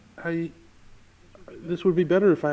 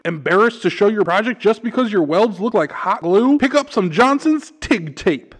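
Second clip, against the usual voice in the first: radio ad read; 5 semitones higher, 5 dB louder than usual. Acted. Reads a mock hardware store ad.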